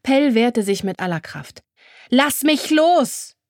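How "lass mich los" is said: high, loud, slow cry; desperate cry, drawn out. A narrator's reading. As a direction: call out to be let go. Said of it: "rief sie".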